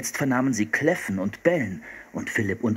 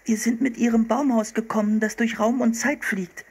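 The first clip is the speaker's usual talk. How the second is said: put-on high girlish explanation; young girl Anne; 12 semitones higher than usual. Girl telling the hunter about the treehouse, high voice. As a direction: keep it pitched high like an eager girl.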